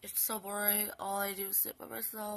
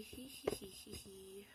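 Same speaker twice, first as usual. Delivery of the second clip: mock giggle; 8 dB quieter, slower than usual.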